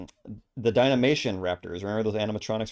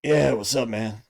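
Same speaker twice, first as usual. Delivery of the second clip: loud mock gruff voice; gruff guy; 7 dB louder, slower than usual. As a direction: greet like a gruff thirty-something dude. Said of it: "a kind of a gruff voice".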